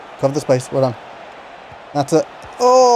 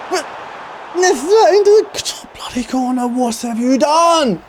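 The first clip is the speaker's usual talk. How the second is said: exasperated outburst; 8 semitones higher than usual. Real, not acted. Conceding a goal, exasperated.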